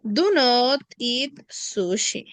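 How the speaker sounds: slow, even teacher model; deliberate classroom model; slow model sentence. Shown as a demonstration. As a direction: say it slowly for them to copy. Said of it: "Very good".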